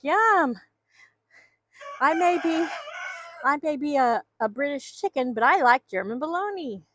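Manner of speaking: light high voice for the hen, a bit playful; voicing the hen. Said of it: "she's like".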